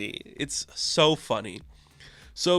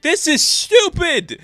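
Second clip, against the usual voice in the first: much higher, louder, slow mock groan; fed-up Thor; 8 dB louder, slower than usual. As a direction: drag it out, totally fed up. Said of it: "And he's like".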